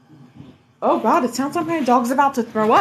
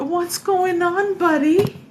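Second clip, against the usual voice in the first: pet talk; 6 semitones higher, 3 dB louder than usual. Real, not acted. Turns to dog mid-sentence.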